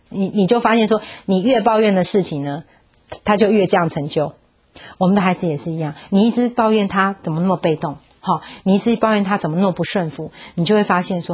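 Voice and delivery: ordinary talk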